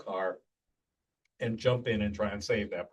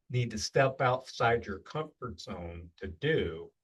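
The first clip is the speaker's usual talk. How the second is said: normal talking voice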